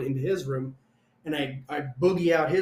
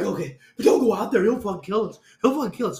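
louder, higher panicky plea; acted frantic warning; 5 semitones higher, 7 dB louder, faster than usual. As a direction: plead urgently, as if scared to death. Re-enacts pleading with duty driver.

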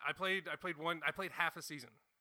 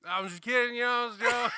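loud backpedal; 6 semitones higher, 11 dB louder than usual. Retold or imitated. Backpedaling after rejected advance.

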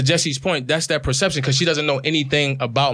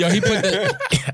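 laughing; 7 semitones higher, slower than usual. Real, not acted. Cracking up at the joke.

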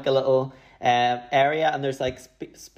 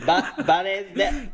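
put-on Jamaican accent; 4 semitones higher, much slower than usual. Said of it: "Brussels sprout in a Jamaican accent".

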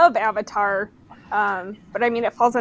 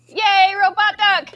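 high, drawn-out happy cheer; delighted squeal of a fan; gleeful cheer; 9 semitones higher, slower than usual. Real, not acted. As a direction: cheer it slowly with a soaring pitch. Cheering over a robot duck.

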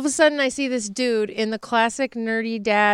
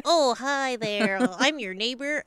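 mock nerdy neighbor; 3 dB quieter than usual. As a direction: drag it out like a sheepish nerdy dad. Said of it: "And he's like".

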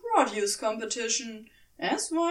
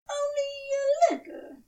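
much higher, fussy angel voice; sheepish admission, voice pitched way up; Aziraphale. A narrator's reading. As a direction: Aziraphale admits it to Crowley, sheepish and high.

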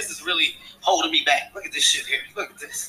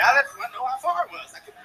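ordinary delivery

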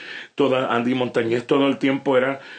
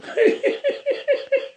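very high-pitched mimicked laughing fit; high cackling laugh in imitation; imitated laughter. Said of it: "no, reía".

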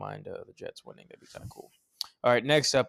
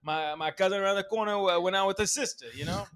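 put-on Italian-American accent; 4 semitones higher than usual. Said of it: "it's the fucking hardest Italian".

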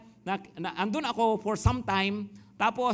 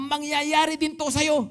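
slow, louder, higher curse; retold angry curse; 7 semitones higher, 5 dB louder, slower than usual. Sister burned by porridge curses her brother, slow and angry. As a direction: lay the curse on slowly, a bit heated. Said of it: "Sa galit niya sa akin".

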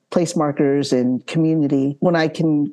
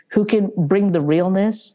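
ordinary delivery

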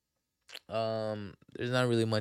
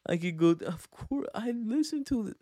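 mocking girl's voice; 7 semitones higher than usual.

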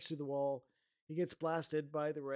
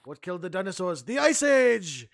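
Arnold Schwarzenegger; 2 semitones higher, 12 dB louder than usual. Retold or imitated. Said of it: "Thanks, Arnold".

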